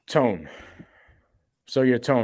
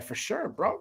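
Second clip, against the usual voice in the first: easy host reply; 10 semitones higher, 6 dB quieter, slower than usual. Retold or imitated. Host agreeing, acted quote.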